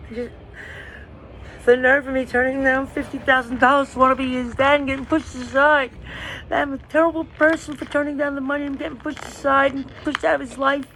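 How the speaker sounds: high, strained voice on the edge of crying; fighting back tears while venting; tearful. Real, not acted. Venting to the camera about being pushed aside, tearful and strained.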